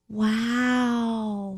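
put-on long drawn-out, hushed baby wow; cute baby voice. Baby niece at candlelit church service, cute awed voice. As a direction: imitate a tiny child's awed wow, softly. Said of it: "in the cutest voice you've ever heard".

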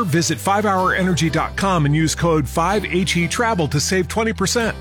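ordinary delivery

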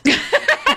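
squealing laugh bursts out; high laugh. Real, not acted. Laughing at husband's reaction.

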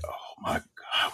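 hushed, breathy disbelief; dismayed murmur. Retold or imitated. Man alone at the sink as his hand fails, hushed dismay. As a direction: mutter it to yourself in dismay. Retells a stunned private exclamation.

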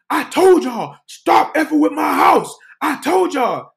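high, loud imitation of a fed-up woman; retold scolding yell; acted-out angry shout. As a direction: holler it as the householder who warned them. Brenda yells about her house.

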